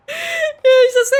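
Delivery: high, delighted; excited. Real, not acted. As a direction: gush over the picture. Excited about image.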